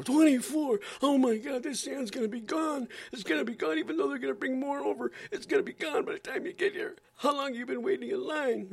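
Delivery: very high, shrill mock alarm; hammed-up reporter freaking out; panicky reporter.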